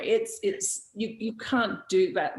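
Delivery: ordinary delivery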